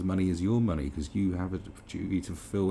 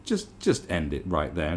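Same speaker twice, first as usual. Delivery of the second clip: ordinary delivery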